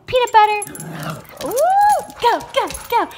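loud eager call; rally the dogs for a treat; excited. Real, not acted. Owner rallying her dogs for peanut butter.

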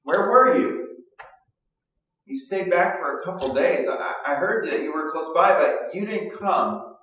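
high, loud, pleading cry of grief; Martha's anguished plea. Retold or imitated. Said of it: "I picture Martha coming to, to Jesus saying".

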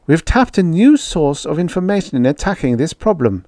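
high, keen scientist making her case; earnest woman researcher. Acted. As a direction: put your case to sceptics.

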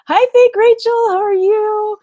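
high, loud, drawn-out hi; squealed welcome to a friend; delighted greeting. Real, not acted. Greeting a favourite viewer.

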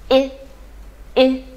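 "i" as a very short, firm "ih"; short forceful vowel. Shown as a demonstration. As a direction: punch out a brief isolated "i", as in miss. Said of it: "发音非常的短促有力啊". (短促有力地发“i”)

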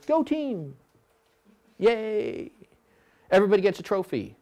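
high, bubbly mock cheer; cheerleader. Coach mocking a cheerleader-style cox, high and chirpy. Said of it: "Don't be a cheerleader".

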